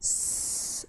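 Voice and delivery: a long unvoiced s hiss. Shown as a demonstration. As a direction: hiss a clean s sound. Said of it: "vai pronunciar o som do". (um s sibilado, sem voz)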